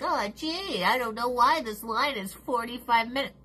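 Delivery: lightly voiced clueless whine; a hint of a dopey clueless voice; mock clueless procrastinator. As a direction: play the oblivious latecomer. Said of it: "you're like".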